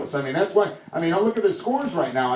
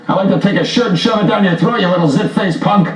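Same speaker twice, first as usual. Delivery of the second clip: angry outburst; 9 dB louder than usual. Acted. Host snaps at prank caller.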